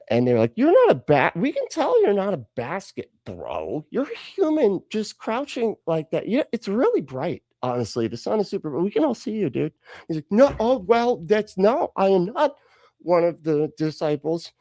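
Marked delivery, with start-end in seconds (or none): 0.6-3.8 high-pitched mock disbelief; hammed-up exasperated onlooker; incredulous call-out. Crowd retold calling out Peter. 3.9-9.7 high-pitched mock disbelief; hammed-up exasperated onlooker; incredulous call-out. Crowd retold calling out Peter. 10.3-14.5 high, stammering mock protest; hammed-up caught-out fibber; flustered denial. Peter retold denying, flustered.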